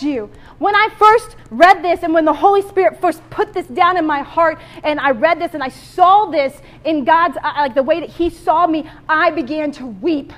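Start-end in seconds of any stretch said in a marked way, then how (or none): none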